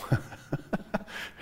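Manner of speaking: self-deprecating chuckle; laugh. Real, not acted. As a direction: laugh at yourself for a moment. Laughs at his own self-description.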